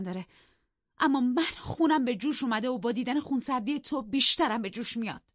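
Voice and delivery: high, loud, heated tirade; boiling over at a cold husband; angry outburst. Acted. پایش را کوبید زمین و دوید وسط حرف. (فوران خشم با صدای بلند و زیر)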